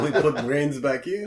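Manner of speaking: high, loud, laughing call; laughing mock surgeon. Riffing on the doctor joke, laughing.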